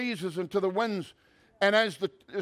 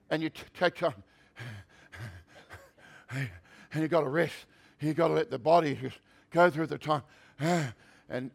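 mock panting between short phrases; pretend out of breath; 5 semitones lower, 3 dB quieter than usual. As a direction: puff and sigh as if winded. Acts being out of breath.

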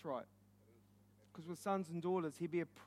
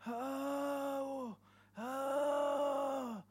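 long, higher wailing 'oh'; imitated distant screams; 6 semitones higher than usual. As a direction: mimic far-off screaming without shouting. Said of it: "that's like people screaming".

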